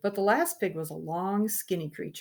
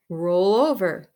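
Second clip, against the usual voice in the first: louder, slow "Roll over" called to the pig; loud command; 12 dB louder, slower than usual. Acted. Boy commanding his trained pig, loud and slow. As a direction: give the pig a firm order.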